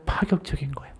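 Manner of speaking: hushed whispered emphasis. Real, not acted. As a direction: lean in and whisper the point. Preacher drops to whisper. (조용히 속삭이듯 강조)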